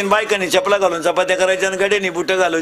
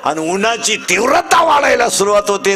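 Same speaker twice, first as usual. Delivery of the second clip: impassioned preaching; 3 semitones higher than usual. Acted. Preacher extolling the Marathi new year to devotees, fervent. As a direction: lift the crowd with pride. Preaching pride in Gudi Padwa.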